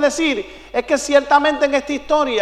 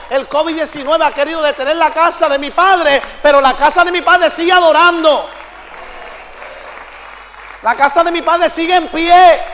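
rousing preaching shout; 3 semitones higher, 4 dB louder than usual. Real, not acted. Preacher rallies the congregation that worship continues, rousing shout. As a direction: build to a high, loud rallying cry.

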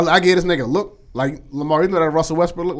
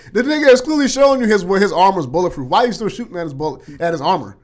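higher and louder, exasperated at the screen; exasperated quote; 4 semitones higher, 5 dB louder than usual. Retold or imitated. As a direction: demand why they keep shooting.

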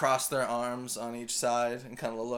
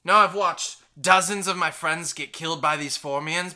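gruff barkeep; 3 semitones higher, 8 dB louder than usual. Acted. GM voices Cliffa denying knowledge.

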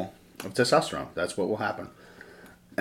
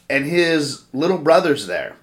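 usual speaking voice

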